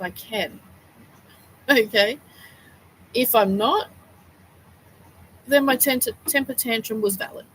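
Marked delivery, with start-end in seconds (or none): none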